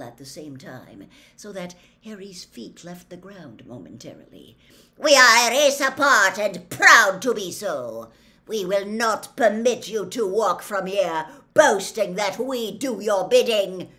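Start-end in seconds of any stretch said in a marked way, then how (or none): 5.0-13.9 loud, proud, raised declaration; snarling centaur. A narrator's reading. Centaur holding Harry proclaims his race's pride, loud snarl. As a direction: declaim it angrily at full volume. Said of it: "snarled the centaur".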